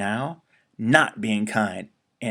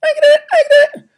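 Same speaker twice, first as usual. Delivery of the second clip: very high, fast little squeak; piping eager squeak of a tiny bird; tiny hummingbird voice; 8 dB louder, much faster than usual. Acted. Hummingbird eagerly offering help, tiny squeaky voice. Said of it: "that's just Hummingbird".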